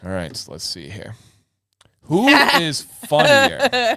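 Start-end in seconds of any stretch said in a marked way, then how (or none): 2.0-4.0 slow, higher, laughing mid-question. Real, not acted. Host laughing while reading the next prompt. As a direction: crack up reading it.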